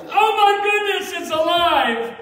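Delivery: high, loud, amazed carnival barker; hammed-up astonished street performer; Arthur Harris's showman act. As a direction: gasp and marvel at the hat to draw a crowd. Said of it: "And he'd go like this".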